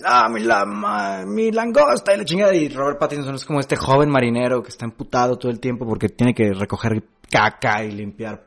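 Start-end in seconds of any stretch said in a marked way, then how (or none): 0.0-2.6 put-on ranting old sailor, pitched high and loud; grumpy old sailor. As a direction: rant like a cranky old crab. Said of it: "es como un viejo cascarrabias, como Don Cangrejo".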